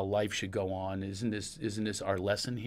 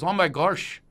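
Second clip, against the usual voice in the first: put-on a raised, louder Pennsylvania accent on "Oh my gosh"; lightly put-on Pennsylvania accent, voicing the tour guide; 7 semitones higher, 5 dB louder, slower than usual. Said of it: "that's the Pennsylvania accent".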